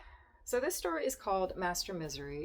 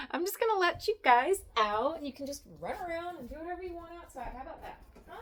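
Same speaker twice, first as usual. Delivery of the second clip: high sing-song pet voice; 11 semitones higher, 5 dB louder, much faster than usual. Real, not acted. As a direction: coax the cats cheerfully. Baby talk to cats.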